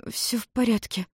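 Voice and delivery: put-on a soft, breathy girl's sigh; breathy exhale. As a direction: breathe the words out softly in relief. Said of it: "выдохнула Майами".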